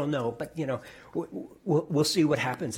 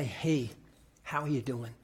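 lower, soft and caring; gentle concerned greeting; 4 semitones lower, 6 dB quieter than usual. Shown as a demonstration. Pastor showing how to greet a grieving friend, softly. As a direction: ask it gently, as to someone grieving. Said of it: "they're grieving".

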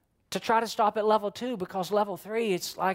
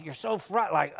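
ordinary talk

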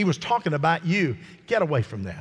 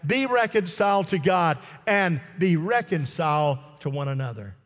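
raised, fervent preacher voice; preaching; 8 semitones higher than usual. Acted. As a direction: preach it with full force. Preaching at full force.

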